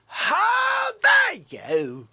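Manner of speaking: hammed-up furious dad; mock outrage. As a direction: wail it in exaggerated fury. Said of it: "I said".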